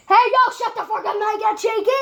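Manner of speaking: fast, high angry yell; angry shout. Real, not acted. Yelling at others off camera.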